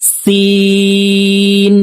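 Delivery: সিন held very long, air hissing out; three-alif long sin. Shown as a demonstration. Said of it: "তিন আলিফ টেনে পড়তে হবে".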